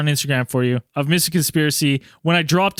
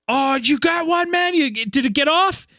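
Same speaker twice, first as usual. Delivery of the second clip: high-pitched eager questions, acted out; retold excited asking; 9 semitones higher than usual. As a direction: call to your fishing buddy about his catch. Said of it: "And I'm like".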